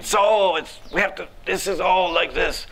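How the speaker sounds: high, put-on voice of an old teacher holding forth; imitating the elderly teacher mid-lecture; lecturing teacher. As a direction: mimic a pompous scholar explaining. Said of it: "Murari Gupta saying".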